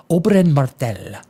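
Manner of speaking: "Oberyn Martell" in a light Spanish accent; a hint of a Spanish accent on the name. Shown as a demonstration. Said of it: "I even say with a Spanish accent".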